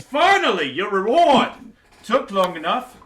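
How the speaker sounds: very loud, high exclamation; impatient king. Acted. King tossing gold to the party, shouting high. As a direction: blurt it out with relieved glee.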